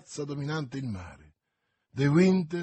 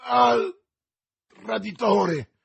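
high, slow cry of alarm; drawn-out shout of betrayal; 6 semitones higher, 8 dB louder, much slower than usual. A narrator's reading. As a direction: cry out loudly as if just stabbed. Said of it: "gridò Buckingham".

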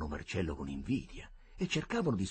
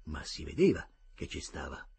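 ordinary delivery, nothing marked

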